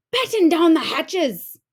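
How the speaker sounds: loud, high shout; storm shout. A narrator's reading. Captain orders crew in the storm, loud shout. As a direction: bark the order over the gale. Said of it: "Braidbeard shouted".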